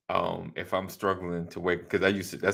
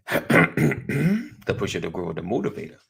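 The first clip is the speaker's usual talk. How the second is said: fighting game announcer. Acted. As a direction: draw the title out like a ring intro. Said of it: "my announcer voice".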